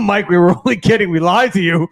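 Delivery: laughing. Real, not acted. Laughing at the prank.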